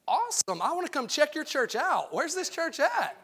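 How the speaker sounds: mock eagerness. Sarcastic imagined eager reply.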